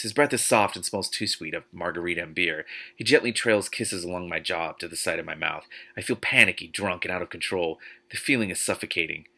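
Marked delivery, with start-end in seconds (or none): none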